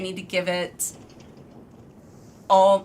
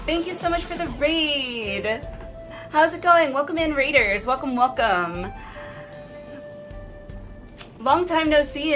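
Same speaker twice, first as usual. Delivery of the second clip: lightly raised bubbly greeting; cute anime streamer; 7 semitones higher than usual. Acted. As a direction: welcome the raiders brightly.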